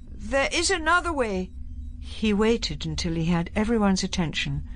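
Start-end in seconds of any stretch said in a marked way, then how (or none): 0.1-1.5 lightly voiced old man, a touch higher and quavering; a hint of an aged quaver in the voice; quavery old man. A narrator's reading. The Doctor to the General, a slight old quaver. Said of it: "slightly higher pitched, quavery with age".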